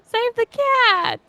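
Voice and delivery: high, wailing mock alarm; hammed-up plea, drawn out and high; mock distress. Player pleading for the wildcat's rescue, high mock wail.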